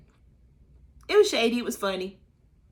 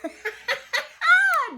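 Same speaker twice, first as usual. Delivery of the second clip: shrieking high laugh; loud high laugh; 6 dB louder than usual. Real, not acted.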